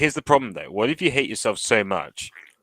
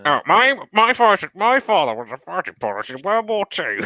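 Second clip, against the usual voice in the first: slightly Australian boomer impression; 9 semitones higher than usual. Mocking a Question Time guest, as an Australian boomer. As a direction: ham up a shouting Australian boomer. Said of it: "I can only do one impression".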